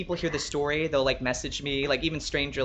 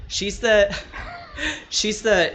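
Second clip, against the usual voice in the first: laughing; 8 semitones higher, 4 dB louder, much slower than usual. Real, not acted.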